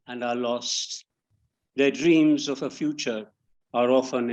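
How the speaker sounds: measured poetry reading with pauses between phrases; poem recitation. Acted. Poet reading an elegy for a great writer, measured recitation. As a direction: read it line by line, letting each phrase settle.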